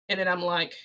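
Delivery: ordinary delivery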